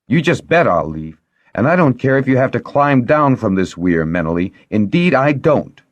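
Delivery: huffy, high-pitched retort; indignant, emphatic defiance; angry parting shot. A narrator's reading. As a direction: storm off with the last word. Angry parting shot before storming out.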